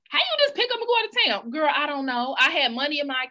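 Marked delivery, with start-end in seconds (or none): 0.1-1.5 nosy people. Retold or imitated. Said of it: "people be like".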